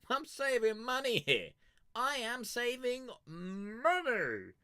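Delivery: high, loud, stretched-out protest; indignant mock retort. Answering people who stare at his two-litre bottle, high and indignant. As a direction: squeal it like you're offended.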